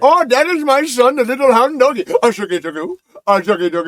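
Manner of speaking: mock Indian accent. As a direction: put on a Rise of Taj Indian accent. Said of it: "with an Indian accent".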